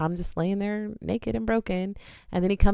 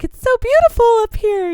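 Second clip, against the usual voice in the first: high, loud, tipsy wonder; giddy giggling; 10 dB louder than usual. Retold or imitated. Drunk woman in the helicopter basket, giggly and awestruck. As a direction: gush like your drunk self, delighted by the view. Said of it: "I'm just giggling and I'm like".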